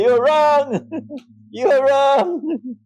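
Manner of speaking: high mock protest through laughter; high laughing imitation of Salafi brothers. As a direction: mimic the protesters while laughing. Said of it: "my Salafi brothers will say".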